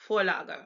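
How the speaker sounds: Vorlage corrected to an F start; German V as F. Shown as a demonstration. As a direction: say Vorlage as 'for-lah-guh'. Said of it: "pronounced like our F".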